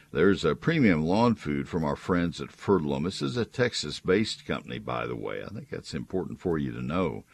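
upbeat radio ad read; chipper 1950s ad. Acted. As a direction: sell it like a cheerful announcer. Live read ad for sponsor.

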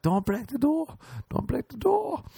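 put-on very high shrieky girly voice; high-pitched girls. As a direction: squeal it very high. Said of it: "the girls are like".

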